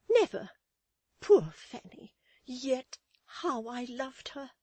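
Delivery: high outburst, slowing at the end; dramatic lament. Acted. Mrs Chick mourns poor Fanny, dramatic lament. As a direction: lament grandly in a matron's high voice.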